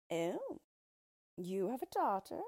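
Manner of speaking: lightly voiced intrigued dwarf; a touch of eager surprise; mild surprised cry. A narrator's reading. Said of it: "cried the Yellow Dwarf".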